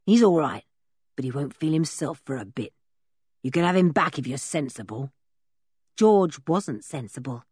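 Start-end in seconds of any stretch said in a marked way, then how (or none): 0.0-5.1 low, rough man's voice; lowered villain voice, unsympathetic; gruff captor. A narrator's reading. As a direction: state it coldly to the captive. Captor's voice in the story.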